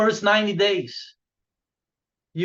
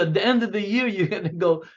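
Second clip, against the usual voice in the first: ordinary talk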